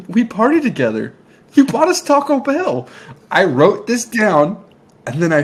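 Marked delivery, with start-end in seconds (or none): 0.1-2.9 mock-betrayed disbelief, pitched much higher; hurt disbelief, voice climbing high; high, wounded imitation of a stunned friend; Dave Franco, stunned. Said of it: "Dave Franco goes".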